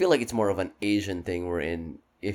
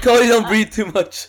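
teasing; 11 semitones higher, 10 dB louder than usual. Real, not acted. Teasing friend, laughing.

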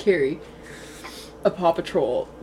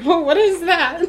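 startled horse voice; 10 semitones higher, 5 dB louder, slower than usual. Retold or imitated. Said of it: "He's like".

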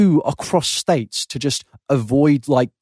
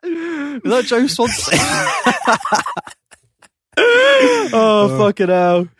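laughing; 10 semitones higher, much slower than usual. Real, not acted. Reacting to impression, laughing.

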